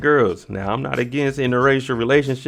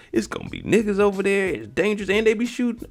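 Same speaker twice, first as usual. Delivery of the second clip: high-pitched retold panic; mock alarm; 11 semitones higher than usual. Emmett alarmed about the studio, high mock panic. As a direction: pitch shoots up as if picturing the danger.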